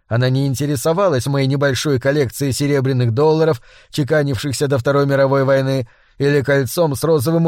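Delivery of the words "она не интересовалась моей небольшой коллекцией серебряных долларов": ordinary delivery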